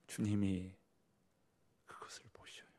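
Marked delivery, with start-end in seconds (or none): 1.9-2.7 whisper. Real, not acted. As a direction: drop to a breathy hush. Preacher drops to a whisper. (속삭이듯)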